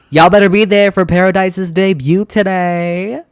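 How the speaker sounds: loud, high-pitched rallying call. Real, not acted. Calling out a reminder to viewers.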